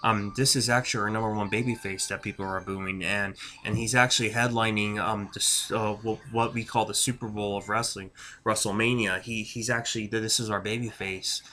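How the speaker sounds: lightly voiced sheepish company man explaining; mock wwe spokesman. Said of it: "That's what the person ... is saying".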